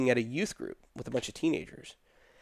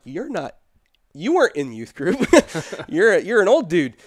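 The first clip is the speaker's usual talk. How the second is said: laughing tease; 6 semitones higher, 9 dB louder than usual. Retold or imitated. Joking with the crying father.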